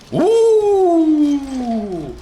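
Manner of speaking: high, loud whoop of joy; real excited whoop. Real, not acted. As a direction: let out a big happy woo. Excited about German food.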